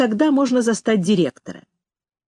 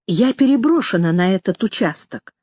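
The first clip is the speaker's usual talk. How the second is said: usual speaking voice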